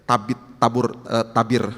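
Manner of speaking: normal talking voice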